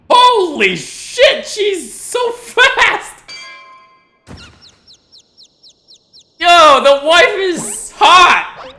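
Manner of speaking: excited yelling. Real, not acted. Streamer hyping the wife on screen, drawn-out excited yells. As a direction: yell in awe at the screen. Streamer awed by wife's speed.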